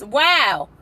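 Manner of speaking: normal talking voice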